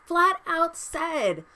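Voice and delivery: "flat" hit high and slow, then "out said" falls; slow, emphatic "flat out said"; stressed flat out. Real, not acted. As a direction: drag out "flat out said" slowly, stressing flat. Repeating for emphasis in rant.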